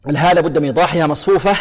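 ordinary delivery

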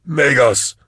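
loud high call; shouting. A narrator's reading. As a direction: shout after him. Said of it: "Vorier shouted".

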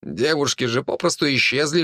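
ordinary delivery

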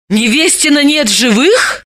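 high startled exclamation; exclaiming in shock. Acted. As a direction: gasp it out in disbelief. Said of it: "Далила воскликнула".

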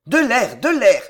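urgent, loud call for air; alarmed cry. A narrator's reading. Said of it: "cria Marillac en pâlissant".

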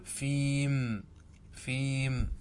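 stretched, slow fiiim; فيم said very slowly, final m closed; pausal stop on fim. Shown as a demonstration. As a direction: hold then stop dead on فيم. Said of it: "كيف تقف؟" فتقول".

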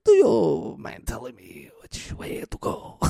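hushed put-on pirate whisper. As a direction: breathe it like a sneaky pirate. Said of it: "He's like".

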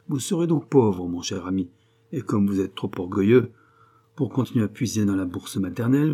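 animated, mocking man about town; lively, needling argument; sarcastic friend, arguing. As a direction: prove your friend wrong with relish.

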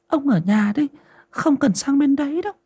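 put-on high-pitched old woman's voice; elderly wife, pitched well up; old wife instructing. A narrator's reading. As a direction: tell your husband to stay put.

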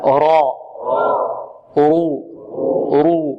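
thick, rounded r held on رو; heavy ra. Shown as a demonstration. Teacher demonstrating tongue shape for pupils, thickened ra. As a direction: say راء and رو with the mid-tongue hollowed. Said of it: "يتقعر هنا وسط اللسان".

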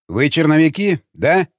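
plain reading voice

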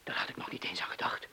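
soft unvoiced whisper. Man whispers that he had not even thought of it. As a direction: let it slip out under the breath.

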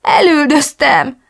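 slow, high tearful word; a broken confession through tears; sobbing. Acted. Chris blaming herself to her friend, sobbing slowly. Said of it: "bőgtem tovább".

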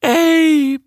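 piercing high, loud cry; shriek. A narrator's reading. Abandoned darling calling her man back, shrieking. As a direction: screech the name out long. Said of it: "kreischte der verlassene Darling".

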